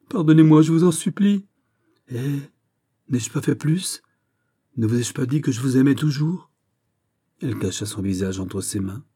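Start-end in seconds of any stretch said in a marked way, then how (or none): 0.1-6.5 high, urgent plea for forgiveness; distraught heroine, imploring. A narrator's reading. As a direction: beg forgiveness in desperation. (jeune femme éperdue, suppliante et aiguë)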